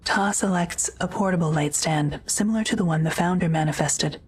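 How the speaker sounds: ordinary talk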